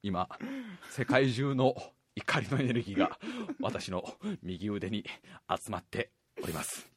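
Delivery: deep hushed build-up to a special move; solemn hero announcement. Pretend. (低く小さくゆっくり必殺技の前口上)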